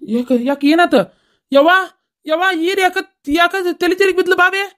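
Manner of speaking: put-on high, panicked questions about the fainted girl; alarmed cry. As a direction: cry out in fright as she collapses. Alarm as the daughter-in-law faints.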